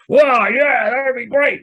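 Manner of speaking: high, over-keen Aussie bloke; Aussie bloke reaction. Retold or imitated. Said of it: "I gave the Aussie bloke, uh, reaction".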